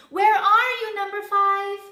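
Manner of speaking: high, loud mother duck's call. A narrator's reading. Missus Duck on her doorstep seeking number five, calling loudly. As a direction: call far across the yard for a lost child. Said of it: "stood on her doorstep calling".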